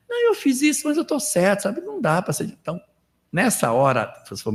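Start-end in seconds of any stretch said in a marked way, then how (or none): 0.1-2.4 high, whiny self-defence; self-justifying voice. Retold or imitated. Imitating a self-indulgent person defending their own action. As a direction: mimic someone excusing themselves, pitched way up. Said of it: "nós somos muito condescendentes conosco".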